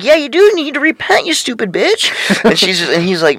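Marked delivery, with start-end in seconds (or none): 0.0-2.1 much higher, shrill-free mock lady voice; Walmart lady. Imitating a preachy lady at Walmart, high mock voice. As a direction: play the ranting Walmart lady. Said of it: "And she's like".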